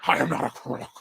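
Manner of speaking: put-on politician voice; Richard Nixon. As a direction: do a hammed-up presidential impression as a horse. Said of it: "I sound like Richard Nixon".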